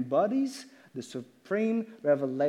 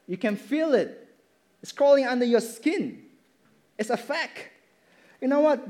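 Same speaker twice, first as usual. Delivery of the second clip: raised, building sermon voice; impassioned preaching; 5 semitones higher, 4 dB louder than usual. Acted. Preacher urging the congregation that truth is felt, fervent. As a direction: drive the point home to the pews.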